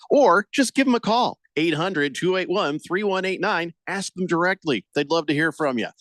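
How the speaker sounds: loud, punchy radio ad read. Acted. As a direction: sell it like a pitchman. Host reads live radio ad.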